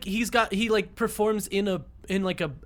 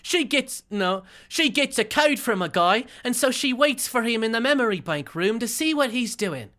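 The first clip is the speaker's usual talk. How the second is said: higher-pitched, badly faked British accent; another accent attempt; 6 semitones higher than usual. Shown as a demonstration. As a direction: read it in a hammed-up British-Australian accent. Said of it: "I'm gonna read the next line in my notes with this".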